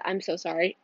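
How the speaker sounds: ordinary talk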